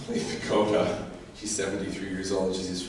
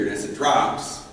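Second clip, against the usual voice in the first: ordinary delivery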